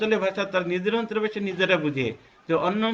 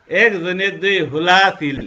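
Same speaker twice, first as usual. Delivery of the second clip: louder Noakhali dialect demo; 6 dB louder than usual. Shown as a demonstration. Teacher showing how Noakhali people say it, louder. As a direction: say হোলা the Noakhali way, loud. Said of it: "ঠিক যখন নোয়াখালী যাব".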